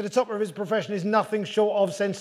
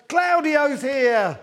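excited party cheer; 4 semitones higher, much slower than usual. Retold or imitated. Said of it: "everyone going".

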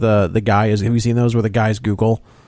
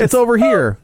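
high, excited forum guy; 9 semitones higher than usual. Retold or imitated. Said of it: "one guy's like".

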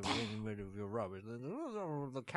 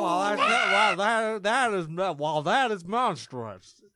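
outraged; 6 semitones higher, 12 dB louder, slower than usual. Acted. Outraged neighbor reaction.

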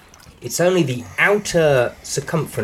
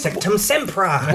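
spell casting; 5 semitones higher, slower than usual. Acted. Wand joke, casting a spell.

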